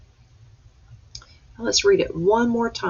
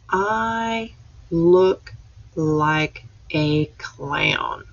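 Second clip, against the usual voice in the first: slow read-aloud; much slower than usual. Shown as a demonstration. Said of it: "let's read this sentence one more time".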